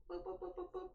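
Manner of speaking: quick string of high boops; scanning boops. Real, not acted. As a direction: boop along in a high voice while checking. Scanning sound while checking for accents.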